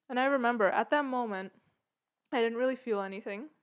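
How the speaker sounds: normal talking voice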